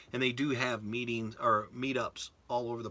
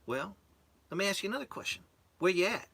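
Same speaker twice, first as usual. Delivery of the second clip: put-on higher Southern-accented setup; 9 semitones higher, faster than usual. Instructor setting up the trainee, high Southern accent. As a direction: lead him on in a high Southern twang. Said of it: "who's got the accent".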